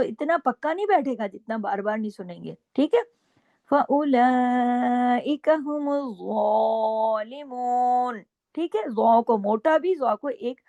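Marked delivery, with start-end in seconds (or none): 3.7-8.2 slow melodic tajweed chant; slow Quranic recitation with held vowels. Shown as a demonstration. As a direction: recite the verse slowly, stretching each vowel. Recites verse again.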